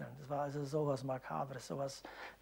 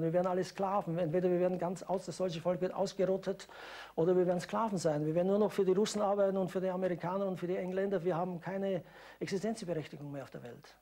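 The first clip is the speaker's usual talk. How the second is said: agitated; 5 semitones higher, 5 dB louder than usual. Real, not acted. Witness recalls propaganda, agitated.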